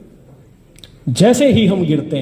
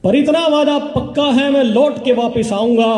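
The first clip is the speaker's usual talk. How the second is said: measured, rhythmic reciting of verse; stage poetry reading; poem recitation; 2 semitones higher than usual. Acted. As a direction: declaim each line to the audience, letting it fall. Recites poem, repeating lines.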